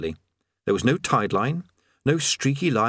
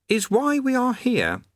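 put-on high young boy's voice; lightly high-pitched youth voice; boy Eric; 14 semitones higher, slower than usual. Eric's line.

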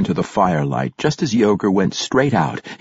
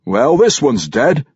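bystander; 6 semitones higher, 4 dB louder than usual. A narrator's reading. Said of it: "said a man over by Yogur".

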